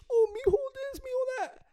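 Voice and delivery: very high-pitched mock-mom voice; imitated mom. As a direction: mimic a doting mother in a squeaky falsetto. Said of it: "she was like".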